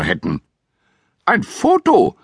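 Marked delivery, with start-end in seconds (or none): 1.2-2.2 much higher, startled "Foto?"; surprised cry. A narrator's reading. Housekeeper startled by the photo idea, high surprised cry. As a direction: squeal it out in surprise. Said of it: "rief Misses Bird".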